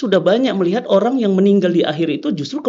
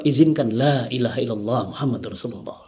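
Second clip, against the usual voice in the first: low, soft voicing of the shahada; quiet last request; 9 semitones lower, 6 dB quieter than usual. Condemned man asks leave to say his final shahada, quiet and low. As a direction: retell the plea gently, well below usual pitch. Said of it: "Dia bilang apa?".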